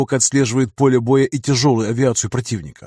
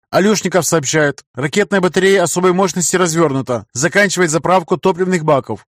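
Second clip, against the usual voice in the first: urgent report; 5 semitones higher, 3 dB louder than usual. Acted. Signalman reports to the emperor.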